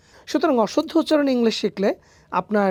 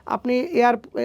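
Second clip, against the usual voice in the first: ordinary talk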